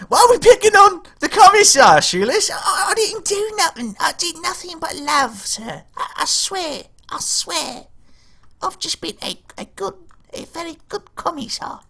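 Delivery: put-on high pleading old woman voice; old granny voice. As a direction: plead innocence like a frail grandma. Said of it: "the old granny voice".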